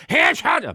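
high, loud drawn-out whoop; lusty cry. Pretend. Husband after the pretend celebratory drink, lusty cry. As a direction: let out a big noisy cry.

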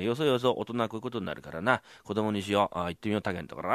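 normal talking voice

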